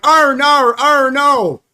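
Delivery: high, loud hammed-up groan of fake disappointment; mock dismay. Gleeful fan mocks Australia losing to Egypt, loud hammed-up wail. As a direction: ham up fake grief over the lost match, loud and high. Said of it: "I'm like".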